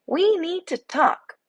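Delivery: high, slow bee voice laying down terms; a small bee demanding a talk; stern little bee. A narrator's reading. Bee confronts Benedict.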